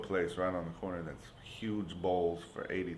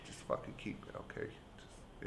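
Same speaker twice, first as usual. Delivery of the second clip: unvoiced, much softer resigned aside; muttered give-up; 10 dB quieter than usual. Paying the coconut lady to end it, whispered and defeated. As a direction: breathe it out, defeated. Giving up, handing money.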